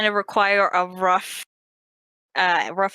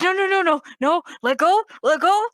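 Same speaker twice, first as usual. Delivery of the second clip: high, urgent cries to let go; Charlie panicking; 10 semitones higher, 3 dB louder than usual. Acted. Charlie yelling at his dog to let go, high panicked cries. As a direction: yelp at the dog to release the ankle. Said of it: "He's like".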